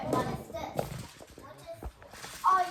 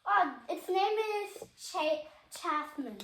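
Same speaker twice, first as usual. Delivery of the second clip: ordinary talk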